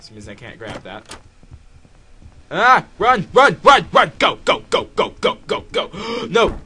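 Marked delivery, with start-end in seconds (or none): 2.5-6.6 loud, high rapid-fire cries; panicked yelling. Real, not acted. Player urging the character to run, panicked yelling. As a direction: fire out each word in panic.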